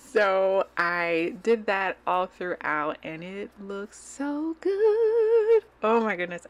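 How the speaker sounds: real, giddy excitement in the voice; excited. Real, not acted. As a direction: gush over how good it looks. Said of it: "you guys can probably tell, like, a difference in my voice".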